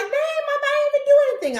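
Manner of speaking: high mock whiny kid; son caught. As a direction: play the busted teenage son, laughing. Son voiced in story.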